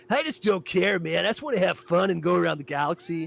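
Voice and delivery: put-on laid-back, drawling rich kid; lazy don't-care slacker. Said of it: "And he's like".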